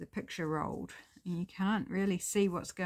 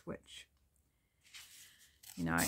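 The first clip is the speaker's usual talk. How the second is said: normal talking voice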